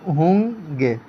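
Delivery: ہوں گے drawn out, nasal ہوں held apart from گے; nasal, split in two. Shown as a demonstration. Teacher shows the separately written form, long nasal vowel. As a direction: hum ہوں slowly, leave a gap before گے. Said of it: "الگ لکھیں گے تو".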